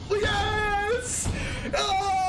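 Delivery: triumphant yelp; cry of joy. Real, not acted. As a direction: whoop at the lucky draw. Game swings his way.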